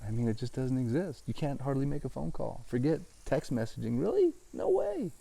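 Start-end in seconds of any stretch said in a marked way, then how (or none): 4.0-5.1 high mock surprise; mock disbelief. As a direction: squeak it out in fake shock. Mock disbelief at phone service.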